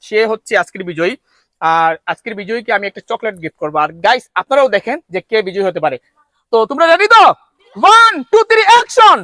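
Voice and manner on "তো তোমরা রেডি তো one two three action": loud, high race-start countdown; booming prize announcer. Real, not acted.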